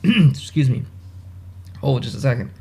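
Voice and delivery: clearing a choked throat; choking. Real, not acted. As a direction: speak just after choking on something. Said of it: "went down the wrong pipe there".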